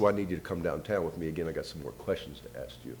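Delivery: imitated detective, low and soft, fading; quiet official request. Detective's retold request to come downtown.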